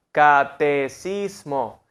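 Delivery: catecismo drawn out slowly and flat; flat monotone. Shown as a demonstration. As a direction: say Catecismo slow, with no melody. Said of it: "tonalidad plana".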